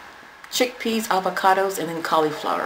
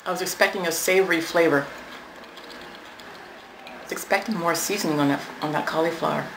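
chewing while talking; mouth full; 3 dB quieter, faster than usual. Real, not acted. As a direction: hand covering chewing mouth. Seen 0: Bite at -9; closed jaw, hand covering mouth while talking.

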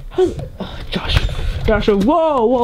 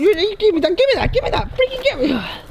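high, urgent, repeated demand; frantic demand; 7 semitones higher, faster than usual. Real, not acted. Demands the item back mid-fight, frantic. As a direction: demand it urgently in a raised pitch. Grabbing during quarrel.

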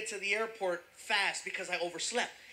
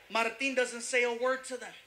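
ordinary delivery

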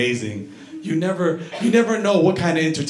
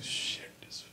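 whispered swearing under breath; muttered curse; 20 dB quieter than usual. Retold or imitated. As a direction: curse quietly to himself. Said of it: "He's like".

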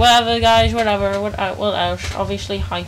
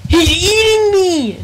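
high, slow, loud panicked yell; real alarmed shout; 10 semitones higher, 6 dB louder, slower than usual. Real, not acted. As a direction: scream it as you're being eaten. Cow eats his avatar in game.

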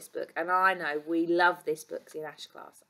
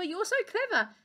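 admiring praise; 12 semitones higher than usual. Acted. Keeper thanks the doctor.

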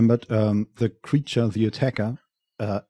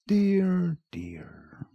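lightly creepy villain crooning; 8 semitones higher, 5 dB quieter than usual. Acted. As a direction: croon it like a predator. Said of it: "you have a good, creepy, uh, villain voice".

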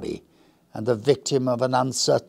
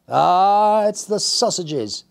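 laughing; 10 semitones higher, 6 dB louder, slower than usual. Acted.

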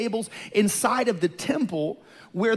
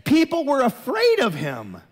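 raised, high-pitched pulpit challenge; impassioned preaching; 6 semitones higher, 3 dB louder than usual. Real, not acted. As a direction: drive each claim home forcefully. Preacher challenges soft image of Jesus.

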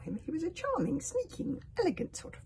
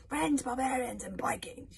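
loud, raised call to a crowd; yelled address; 7 semitones higher, 4 dB louder than usual. A narrator's reading. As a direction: hail the assembled warriors. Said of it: "he yelled".

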